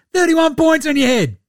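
high, loud mock taunt; mock defiant shout. Player getting back at the Nuggets, mock defiant shout. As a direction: yell it as a hammed-up boast. Acted defiant boast of Nurkic.

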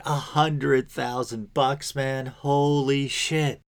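slow, high disbelieving drawl; stunned at the salary, slow and high; incredulous. Retold or imitated.

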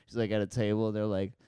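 ordinary talk

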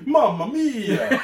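high, loud, slow laughing Mamma mia in a hammed-up Italian accent; mock Italian exclamation. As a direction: throw up the hands and cry Mamma mia, laughing. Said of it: "for me it's like".

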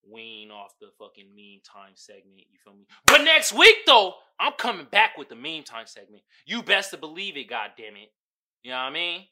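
ordinary talk

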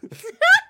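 very high squeal of laughter. Real, not acted. Host giggling after his Australian bit, squealing. Cracks up again.

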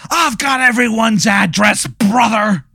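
high shouted wrestler boast; hammed-up wrestler. Wrestler bragging to the guest, in a high shout. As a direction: brag it like a pro wrestler.

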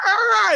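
high shrieking monster wail, imitated; sudden pained monster yelp, mock; whining kaiju cry. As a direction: screech it like a wounded Gamera. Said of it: "you hear his whining".

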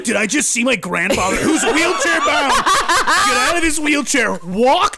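loud, high, incredulous retelling over laughter; retold astonishment. Grandson retelling grandfather's miracle walk to friends, hammed-up astonishment. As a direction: stretch out each step in loud disbelief. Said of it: "I was like".

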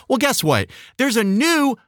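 usual speaking voice

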